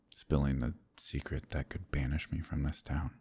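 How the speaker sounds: normal talking voice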